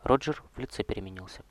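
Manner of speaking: ordinary delivery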